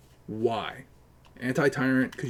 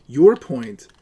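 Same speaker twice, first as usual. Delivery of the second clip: Your punched loud; stress on your; 11 dB louder than usual. Real, not acted. Debater pressing an opponent's claim, hammering Your. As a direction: hit Your hard and drop. Repeats with same force.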